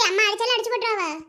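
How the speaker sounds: normal talking voice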